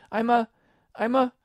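high, loud stammered start; halting high girlish voice; faltering Alice. A narrator's reading. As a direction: break off, searching for the answer. Alice falters at naming herself.

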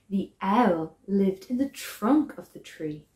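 plain reading voice

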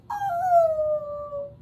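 gasp, then long high "oh"; touched gasp and aww. Acted. Reader moved by the kind words, high cooing gasp. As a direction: melt with a high, drawn-out coo.

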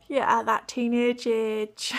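ordinary talk

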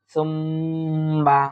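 "সুম্মা" with a long nasal hum on the m; obligatory ghunnah. Shown as a demonstration. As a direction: hold the doubled m in "সুম্মা" through the nose. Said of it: "ওয়াজিব গুণা করার চেষ্টা করব". ("সুম্মা"-র মীমে গুন্নাহ করে টেনে পড়া)

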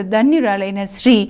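usual speaking voice

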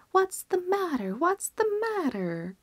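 high, urgent alarm from the crowd; crying out. A narrator's reading. Fair crowd running up, crying out in alarm. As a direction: call out as they come running. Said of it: "cried they".

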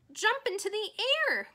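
loud, high cry. Acted. Charlotte cries commands to the leaping pig, loud. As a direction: shout the command across the yard. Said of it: "cried Charlotte".

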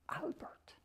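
breathy secret whisper of Albert. Shown as a demonstration. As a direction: hush the name Albert to a whisper. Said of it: "nobody ever called him".

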